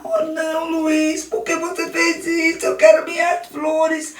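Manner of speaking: put-on high, tearful child's wail; Daniel crying over his trampled flowers, high child voice; crying little boy. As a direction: whimper out the loss. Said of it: "começou a chorar".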